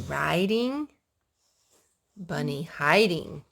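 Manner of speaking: slow, sing-song picture book reading; slow storybook read-aloud; reading to children. Acted. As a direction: linger on each bunny word for little ears.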